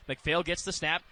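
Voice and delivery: ordinary talk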